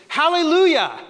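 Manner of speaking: high, loud hallelujah; sudden loud hallelujah from a stranger; joyful shout. Acted. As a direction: burst out with it. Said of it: "suddenly turns around and says".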